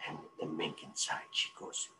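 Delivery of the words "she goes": ordinary delivery, nothing marked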